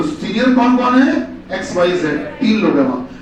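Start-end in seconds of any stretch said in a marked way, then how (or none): none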